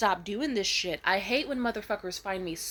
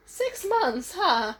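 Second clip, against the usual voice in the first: voice cracking; 8 semitones higher, 3 dB louder, much slower than usual. Retold or imitated. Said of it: "her voice cracked and she was like".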